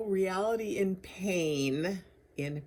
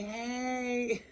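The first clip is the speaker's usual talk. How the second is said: a higher, lightly sung-out greeting to the tree; playful greeting to a tree; 6 semitones higher than usual. Pretend. Visitor greeting the memorial tree, light and playful. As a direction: coax the tree into a hug, playfully. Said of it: "and just say".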